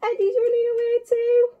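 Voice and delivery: high, squealing storybook alarm; mock panic. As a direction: keep it high as if fleeing in fright. Acting out the frightened running away.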